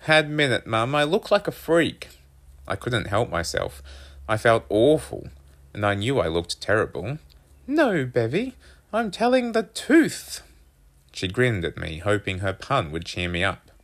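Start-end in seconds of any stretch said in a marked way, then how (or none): 0.1-2.1 put-on raised, louder boy's voice; protesting son. A narrator's reading. Narrator voices upset boy protesting. 7.7-10.4 very high mother voice; punning mum. A narrator's reading.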